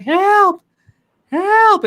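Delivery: very high, loud, wailed cries; imitated screaming for help. Imitating the woman screaming across the street, high loud wail. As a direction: shriek it out in falsetto. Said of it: "she's like".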